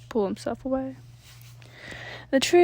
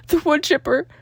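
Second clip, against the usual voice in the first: higher, louder alarmed cry; horrified exclaim; 5 semitones higher, 7 dB louder than usual. A narrator's reading. Narrator's horror reveal of the forgotten wood chipper. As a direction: blurt the horror out.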